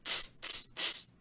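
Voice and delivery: three sharp tss sounds, no voice; mocking monkey "tss". A narrator's reading. Monkeys shaking fingers back at the peddler, mocking tss sounds. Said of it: "stamped their feet back at him and said".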